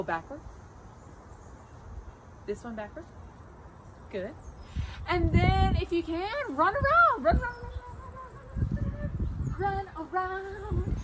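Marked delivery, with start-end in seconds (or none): 5.1-11.0 high, bouncy run run run; excited calling. Shown as a demonstration. Teacher urging kids to run, excited and high. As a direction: cheer them on to run.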